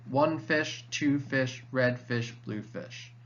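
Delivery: ONE fish, TWO fish, RED fish, BLUE fish; stress on first words. Shown as a demonstration. Teacher showing natural rhythm, stress on the word before each fish. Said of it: "all the stressed syllables come first".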